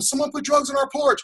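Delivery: put-on very high, scared girl's cry; the terrified daughter blurting it out; frightened daughter.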